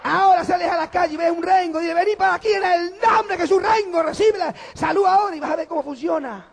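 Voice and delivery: fiery pulpit shout; preaching at full force. Acted. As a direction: thunder it to the congregation.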